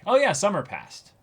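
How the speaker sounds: high, loud, breezy "oh yeah"; mock breezy exclamation. Mocking the show's time skip, high and loud. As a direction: toss it off brightly, pitch jumping up. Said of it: "to be like".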